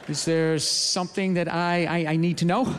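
low, subdued, halting questions; a gorilla sensing bad news, asking low and hesitant; deflated gorilla. Gorilla warily asks the lady what's wrong, low and deflated. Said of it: "the gorilla's energy just comes all down".

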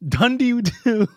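high, slow, mock-solemn echo; silly drawn out. Contestant echoing the Dundee counting joke, high and slow.

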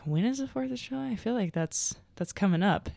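high, puzzled sleep-brain voice; drowsy and confused; sleepy confusion. Retold or imitated. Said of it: "at sleep brain".

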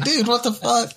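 high, exasperated mock friend. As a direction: squeak it in disbelief. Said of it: "I'm like".

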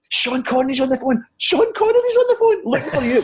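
very high, squealed announcement; mock excited yell. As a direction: imitate a colleague yelling in disbelief. Colleague shouting the news to him.

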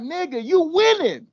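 high, loud, slowing exasperation; disbelieving rant. Retold or imitated. Podcaster scolding the knight, mock exasperated disbelief. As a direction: plead with him to see sense. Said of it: "I'm like".

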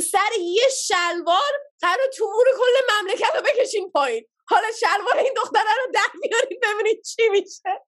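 rapid exasperated tirade; exasperated rant. Retold or imitated. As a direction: throw up your hands and rant. Cousin ranting at guards in Persian.

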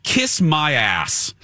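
slow, emphatic snap back; fed-up retort. Retold or imitated. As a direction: retell a defiant comeback, each word stretched. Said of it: "I was not in the mood".

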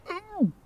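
high sustained "ooh" that drops off; high excited squeal of "ooh"; retold "ooh". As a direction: let out a high-pitched ooh, losing your head. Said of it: "I just kind of lost my head and went".

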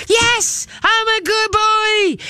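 very high, drawn-out doggy squeal; be an overexcited dog going crazy at praise; excited dog. Acted. Trainer voicing the dog praised with good boy, high crazy excitement. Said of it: "What does the dog do?".

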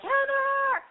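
high, loud squealing greeting; excited squeal of a name. Pretend. Calling out to a friend in New York, high squeal. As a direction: call her name like spotting an old friend. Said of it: "I'm gonna be like".

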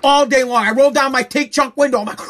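ordinary talk, nothing marked

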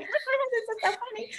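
high, strained fake laugh through the words; forced laughter. Mom laughing nervously while her son yells, high fake laugh.

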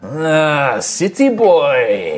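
wild elf captor. Acted. Wild elf turning over his captive, slow taunting. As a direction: draw it out mockingly over the prey. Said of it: "you can see this kind of wild elf".

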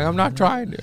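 high-pitched defensive reply; mock protesting. Arrested employee answers his coworkers, mock defensive protest. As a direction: protest innocence with pitch jumping up.

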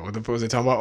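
ordinary talk, nothing marked

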